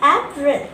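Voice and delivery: April said as a careful model word; model pronunciation. Shown as a demonstration. Teacher models April for learners, careful pronunciation. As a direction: say April clearly in two syllables. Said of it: "it will be pronounced as".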